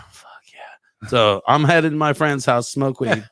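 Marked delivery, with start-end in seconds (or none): 0.1-1.1 sigh, then a whispered cheer; hushed private celebration; retold whispered relief. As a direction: whisper it to yourself, acting out relief. Private relief on being released.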